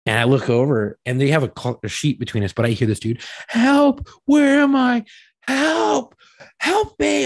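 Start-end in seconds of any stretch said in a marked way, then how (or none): 3.5-7.2 high, drawn-out wailing cries; imitated screaming for help. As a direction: mimic a lost patient calling out. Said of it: "He's screaming for help".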